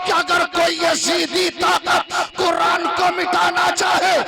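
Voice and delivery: loud, rising fervent preaching; preaching at full force. Acted. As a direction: build to a ringing climax for the crowd. Fiery religious speech on mothers.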